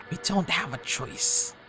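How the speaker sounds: usual speaking voice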